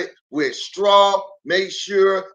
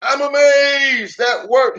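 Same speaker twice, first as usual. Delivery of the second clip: higher, amazed exclamation; amazed wolf; 7 semitones higher than usual. Acted. Wolf amazed after blowing the house down. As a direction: marvel that it worked.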